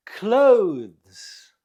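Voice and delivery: "Clothes" as a single loud, falling syllable; one syllable. Shown as a demonstration. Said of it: "It's one syllable".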